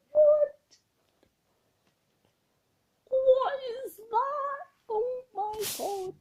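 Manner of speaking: high, slow, wailing shock; real disbelief near tears; stunned disbelief. Real, not acted. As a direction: cry it out, stunned. Shocked at own recording.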